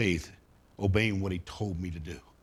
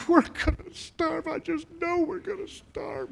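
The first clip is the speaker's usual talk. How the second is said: put-on much higher, teary teenage voice; whiny teen daughter. Teen daughter crossing Missouri, high whiny panic. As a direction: play the dramatic fourteen-year-old sure of doom. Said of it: "here's a fifteen-year-old saying".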